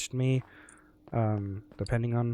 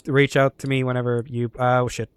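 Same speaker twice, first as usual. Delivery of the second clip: ordinary talk, nothing marked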